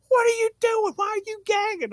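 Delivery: very high-pitched mock maternal alarm; mother confronting child. Mama at the door to gagging child, alarmed scolding. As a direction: demand answers in a squeaked-up mom voice.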